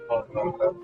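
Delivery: normal talking voice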